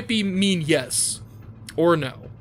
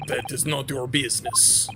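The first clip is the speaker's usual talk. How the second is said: curt low Russian dismissal; guard refusing a question, Russian accent; 5 semitones lower than usual. Acted. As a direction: shut them down flatly, Russian-accented. Sailor voice.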